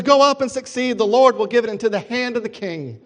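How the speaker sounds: mock-eager, high and loud prophecy; hammed-up sarcastic blessing. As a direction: parrot the false prophets with a sneer. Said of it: "Uh, sarcasm".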